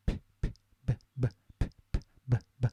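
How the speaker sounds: quiet bare consonants p and b, no vowels; isolated p and b sounds. Shown as a demonstration. Teacher sounding p and b to feel voicing. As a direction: pop out p and b alone, softly. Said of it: "When you say the B sound".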